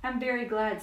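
happy. A narrator's reading. Piglet echoes Pooh about the birthday gift, mildly happy. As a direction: give Piglet's line a little glad lift. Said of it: "said Piglet happily".

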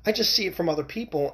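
a hint of an innocent daughter shrugging it off; daughter's reply. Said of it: "And she goes".

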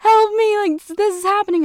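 high, loud faked panic; mock crying plea. Actress friend faking an emergency call, high and panicked. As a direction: play the frantic friend in fake distress. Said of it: "like, crying and was like".

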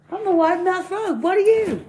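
very high, squeaky frog voice; wide-mouthed frog. Acted. Frog introducing itself to the bear, very high voice. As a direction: announce yourself as a big-mouthed frog, high-pitched. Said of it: "he goes".

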